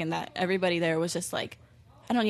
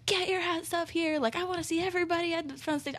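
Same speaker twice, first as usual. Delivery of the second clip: retold stage shout; 9 semitones higher, 5 dB quieter than usual. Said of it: "So I'm shouting at her".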